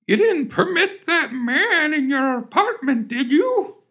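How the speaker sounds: very high, fretful mock-mother voice; nervous mother. Mother on the phone to her daughter, nervous query. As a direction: pitch it way up as an anxious mother. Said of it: "queried the nervous mother".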